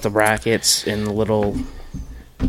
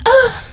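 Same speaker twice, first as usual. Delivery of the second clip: high shrieking tennis grunt; tennis grunt scream. Retold or imitated. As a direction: shriek like a tennis player serving. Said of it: "they're always like".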